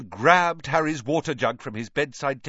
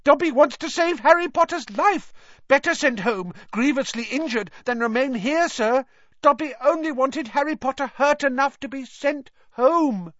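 high, squeaky elf voice; earnest high-pitched servant creature; pleading house-elf; 4 dB louder than usual. A narrator's reading.

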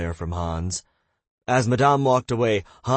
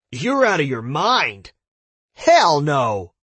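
loud outraged shout; 7 semitones higher, 7 dB louder, slower than usual. Acted. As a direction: yell it in disbelief. Choking at the bill.

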